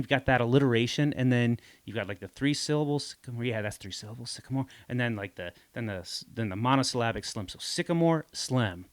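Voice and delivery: ordinary talk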